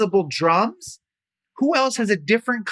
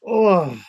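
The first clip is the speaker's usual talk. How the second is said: louder mock groan of being squashed; 6 dB louder than usual. Teacher showing how being trapped in a box sounds, mock groan. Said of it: "What's it look like if you're trapped".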